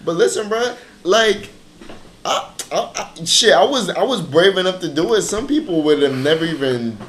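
standing up for yourself; proud, emphatic pushback; defiant. Real, not acted. Defending himself loudly.

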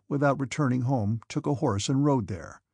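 usual speaking voice

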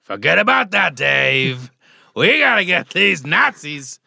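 loud slow Pacino-style rallying cry; loud gung-ho soldier. Retold or imitated. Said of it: "He's very Al Pacino in it".

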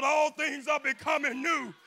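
loud, high whooping preacher climax; full-force preaching. Acted.

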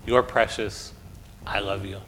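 soft, low and intimate; gently hushed, with pauses to let each phrase land; tender reassurance. Acted. Pastor tells each child God's loving words, soft and tender.

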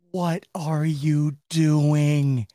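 very slow, drawn-out exasperated wail; exasperated cry. Acted. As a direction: stretch every word in disbelief. Acts out everyone's exasperated reaction.

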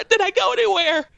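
insistent high-pitched kid, reenacted; frantic child. Disoriented child asking mother on waking, high and insistent. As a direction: press the question like a frantic child.

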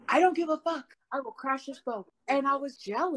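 high, heated outburst; furious rant. Retold or imitated. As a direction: shout it like you'll crash the car.

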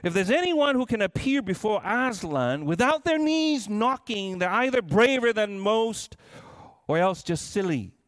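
ordinary delivery

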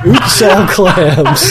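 slow shouted commercial slogan; ad announcer shout. Pretend. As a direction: yell it like a cereal ad.

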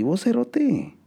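very high, hammed-up slangy voice; cartoonish high Guatemalan voice; exaggerated Guatemalan voice. Mock voice tag.

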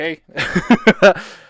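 loud burst of laughter; laughing. Real, not acted. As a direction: crack up loudly after your own bit. Laughs after the acted speech. (carcajada fuerte)

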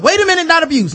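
very high, loud mock panic; mock alarm. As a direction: shriek it in comic alarm. Continues mock teacher panic.

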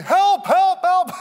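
high, loud comic cries for help; exaggerated squeal of panic; mock panicked cry. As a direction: yelp like someone going under, hammed up. Imitates sinking Peter crying for rescue.

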